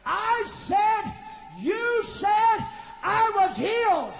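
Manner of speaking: high, slow insistent shout; retold shout. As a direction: sister demanding her healing, high and slow. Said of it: "She said".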